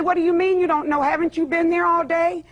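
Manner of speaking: retold challenge. Caller retelling how she questioned Pinky, incredulous challenge. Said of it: "I said".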